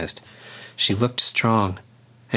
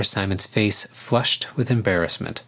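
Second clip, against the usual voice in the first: usual speaking voice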